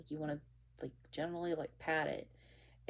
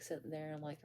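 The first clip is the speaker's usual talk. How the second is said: normal talking voice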